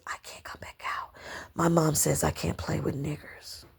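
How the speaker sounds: unvoiced whisper through a half-closed door. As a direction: whisper it like a scared little girl. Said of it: "whispering with the door half closed".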